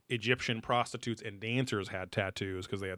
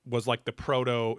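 ordinary talk

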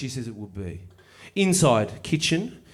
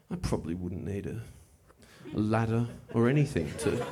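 low, softer thinking to himself; inner thought; 6 semitones lower, 6 dB quieter than usual. Retold or imitated. Sizing up the fence, quiet and low to himself. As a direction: mutter it under his breath. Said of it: "I think to myself".

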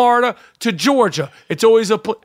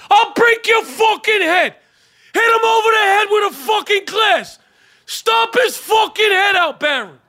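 Aiello impression; 12 semitones higher than usual. Aiello on the plane yelling at the door grabber, furious shouting. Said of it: "Aiello would have cold cocked him".

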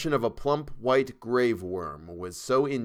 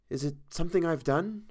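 lightly voiced young woman, higher; a hint of the model asking anxiously; Tessie's voice; 7 semitones higher, 4 dB quieter than usual. A narrator's reading. Model asks painter, lightly voiced young woman.

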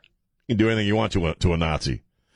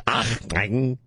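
half German half Mexican. Retold or imitated. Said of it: "so he says".

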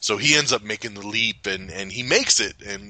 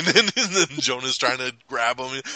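ordinary talk

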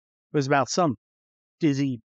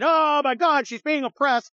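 high-pitched, hammed-up alarm; mocking the protesters, hammed-up panic; mock hysterical; 9 semitones higher than usual. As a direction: parody someone panicking over oppression.

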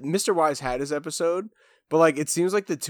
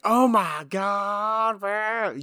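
drawn-out, higher mock gushing; mimicking co-host's freak-out; 5 semitones higher, much slower than usual. Teasing co-host over how she reacts, mock gushing. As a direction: stretch it out in mocking imitation. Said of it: "I don't be like".

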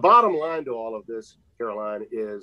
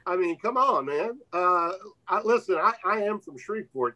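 higher, hammed-up incredulous tone; mock disbelief; 8 semitones higher than usual. Broadcaster teasing panel about Cajun talk, higher mock disbelief. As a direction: tease them with fake shock.

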